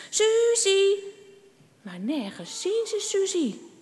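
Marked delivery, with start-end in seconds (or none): 0.1-1.0 loud, high call; searching children calling, raised voice. A narrator's reading. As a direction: shout the name into the snowy woods. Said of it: "Al roepend lopen ze".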